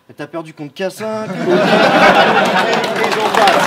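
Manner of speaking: mocking drawl. Mocking rap battle punchline drawn out.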